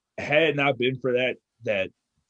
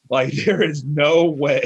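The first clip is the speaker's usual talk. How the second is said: laughing disbelief; 4 semitones higher, 6 dB louder, slower than usual. Retold or imitated. Retelling disbelief at climbing rope.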